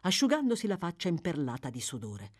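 usual speaking voice

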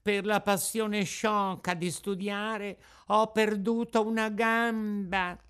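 the sly fox, drawing out a sad lament; the fox pleading in a slow, put-on sorrowful voice. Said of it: "disse la volpe".

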